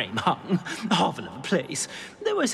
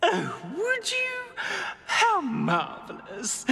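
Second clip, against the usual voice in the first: grateful gush; 7 semitones higher, slower than usual. Acted. Portrait grateful for help.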